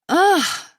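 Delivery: loud high groan of frustration; frustrated groan. A narrator's reading. Sister groaning over the phone in shared frustration. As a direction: let out a fed-up "ugh". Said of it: "My sister groans".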